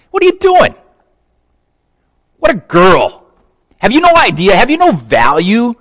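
high, loud, incredulous telling-off; snort, then berate her in outraged questions; acted scolding, pitched high and forceful; indignant scolding. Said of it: "And they scolded her".

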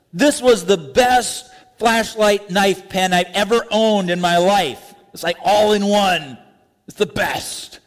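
high, slow, thrilled gushing. Acted. Owner raving about his new flashlight knife pen, gushing. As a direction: rave about it like a kid with a new toy. Gushing over a gadget gift.

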